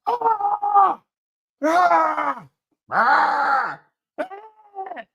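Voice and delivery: short high squeals; high shriek takes. Shown as a demonstration. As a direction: yelp high, four different ways. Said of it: "Throw those four together and you'll get a big ah".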